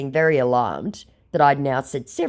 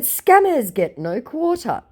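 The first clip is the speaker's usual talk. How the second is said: haughty; 11 semitones higher, slower than usual. Retold or imitated. Own reply, high.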